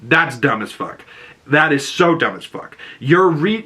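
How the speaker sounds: ordinary delivery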